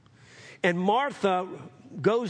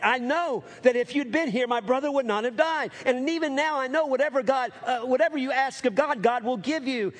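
pitched higher, declaring it with conviction; fervent faith; 7 semitones higher than usual. Shown as a demonstration. Martha declaring faith to Jesus at the tomb, fervent and raised. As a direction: proclaim it like you truly believe. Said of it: "but when we get her in her faith".

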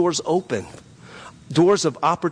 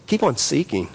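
normal talking voice

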